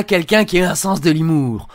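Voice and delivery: ordinary talk, nothing marked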